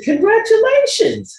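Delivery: high-pitched, gushing cheer; delighted congratulations. Retold or imitated. Actor reacting to his co-star's pregnancy, delighted. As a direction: congratulate her in a high, excited voice.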